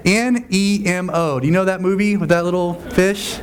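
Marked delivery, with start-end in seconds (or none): 0.1-1.4 slow letter by letter N E M O; spelled out. Shown as a demonstration. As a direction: spell it slowly so the child won't catch it. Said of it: "I'm gonna spell it".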